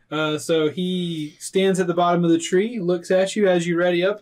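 normal talking voice

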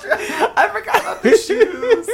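high, loud words through laughter; helpless laughing. Real, not acted. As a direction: squeal it slowly while cracking up. Cracking up at a remembered quote.

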